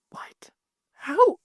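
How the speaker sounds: whispered disbelief, as the mortified mother. Retold or imitated. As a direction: breathe out the questions in shock. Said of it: "she was mortified".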